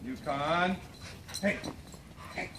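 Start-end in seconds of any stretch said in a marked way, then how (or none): none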